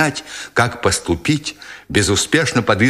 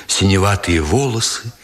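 ordinary delivery, nothing marked

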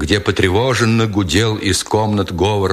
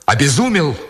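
higher, appalled outcry; desperate cry; 4 semitones higher than usual. A narrator's reading. Nikitin confronts the sergeant, desperate raised pitch. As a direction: cry it out in disbelief, not at full volume. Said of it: "отчаянно крикнул Никитин".

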